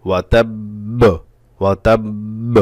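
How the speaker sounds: ওয়াতাব্ব with the b held, then burst out; strong qalqalah. Shown as a demonstration. Teacher shows how to stop on doubled letter, strong bounce. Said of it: "একটু বিকট আওয়াজে".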